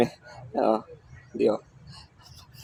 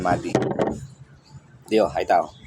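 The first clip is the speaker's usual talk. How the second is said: ordinary delivery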